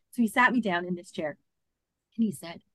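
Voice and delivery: ordinary talk, nothing marked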